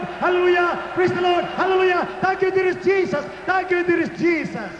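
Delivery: high, sustained calls to the crowd; full-force rallying preaching. Real, not acted. Preacher urging believers to wave hands, high exultant shout. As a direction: stretch the calls and drive the praise on.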